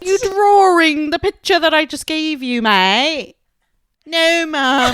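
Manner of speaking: loud, high mock British accent with a laugh. Shown as a demonstration. Teasing her friend in a loud mock British accent. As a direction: ham up a cheeky Brit. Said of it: "There's so many R's".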